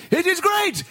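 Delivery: high, gleeful cry; overjoyed king; delighted exclamation. Acted. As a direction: ecstatic praise. King's delighted line after bite.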